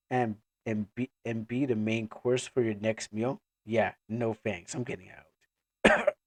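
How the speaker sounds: low, soft refusal, slowing at the end; low and dryly dismissive; sarcastic Ayer. Acted. Ayer refusing the clan's offer, low and sarcastic.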